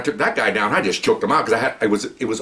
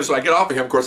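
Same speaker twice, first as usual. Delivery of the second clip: ordinary delivery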